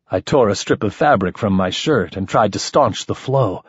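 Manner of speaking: plain reading voice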